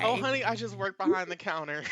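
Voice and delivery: high, loud motherly server; high and loud, honey-sweet lunch lady. Acted. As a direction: brush it off like a sweet cafeteria worker. Lunch lady answers student.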